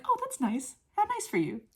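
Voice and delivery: very high, sing-song niceness; exaggerated sweet congratulation; gushing politeness. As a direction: coo approval like a pleased visitor. Boys' sweet reaction, imitated.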